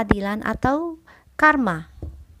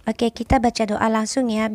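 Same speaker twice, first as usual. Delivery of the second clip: usual speaking voice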